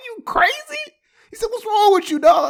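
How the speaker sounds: high, loud laughing friend voice; disbelieving buddy, laughing; incredulous friend. Retold or imitated.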